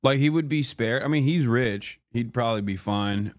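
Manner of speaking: normal talking voice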